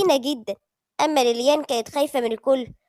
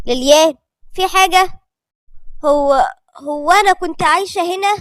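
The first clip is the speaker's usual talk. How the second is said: tense Lilian; 3 semitones higher, 7 dB louder than usual. A narrator's reading. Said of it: "كانت ليليان بتسأل بتوتر".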